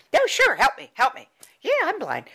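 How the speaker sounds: sweetly taking up a stranger's offer; grateful old man. Retold or imitated.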